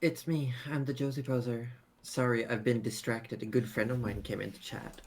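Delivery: ordinary talk, nothing marked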